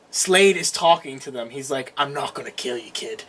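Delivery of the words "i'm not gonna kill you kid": low, quiet villain voice; imitated villain. Retelling villain to his captive, low quiet menace.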